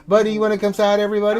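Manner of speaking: high, loud, quick dog call; laughing, eager call to an animal; coaxing a pet. Real, not acted. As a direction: rapidly coax the pet over. Coaxes pet to come.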